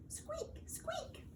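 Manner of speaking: tiny, very high, soft squeak; lantern squeak. A narrator's reading. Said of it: "went the lanterns".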